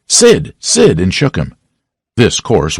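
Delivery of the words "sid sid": high urgent call to wake Sid; hiss-calling his brother awake. A narrator's reading. Tom calling to wake sleeping Sid, high and urgent. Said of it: "He said".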